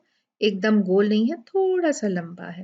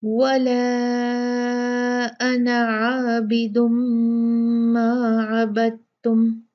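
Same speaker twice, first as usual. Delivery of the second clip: very slow chanted tajweed recitation; Quranic recitation; much slower than usual. Shown as a demonstration. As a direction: recite it, drawing out the madd. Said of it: "ठीक है?". (धीमी तजवीद वाली तिलावत)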